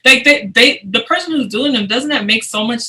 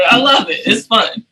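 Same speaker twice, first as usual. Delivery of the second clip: ordinary talk, nothing marked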